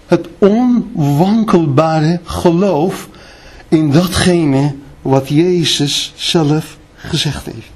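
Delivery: usual speaking voice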